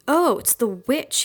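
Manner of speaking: loud, slow jeering cry; cried out. A narrator's reading. Bully in tree yard, jeering cry at Felina. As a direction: call her out in a taunting cry. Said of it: "he cried".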